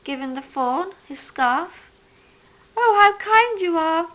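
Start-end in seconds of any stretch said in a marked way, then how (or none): 2.8-4.1 higher, louder grateful exclaim; a moved doe thanking him; touched mother deer. A narrator's reading. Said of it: "said Mother Deer".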